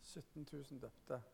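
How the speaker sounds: whisper-soft echo of "Sytten tusen døpte"; hushed repeat. Shown as a demonstration. Preacher repeating the number to the church, hushed in awe.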